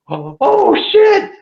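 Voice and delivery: rising to a high yelp; reenacted cry of dismay; horrified cry. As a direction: stretch it out, then jump up high. Retold horror at bike on freeway.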